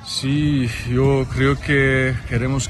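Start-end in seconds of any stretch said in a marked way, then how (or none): none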